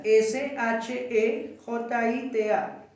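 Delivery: "S H E J I T A" slowly, letter by letter; spelling out. Shown as a demonstration. Teacher answers a student who missed the word, spelling out slowly. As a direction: dictate "S H E J I T A" at half pace, each letter distinct. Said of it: "¿El qué?".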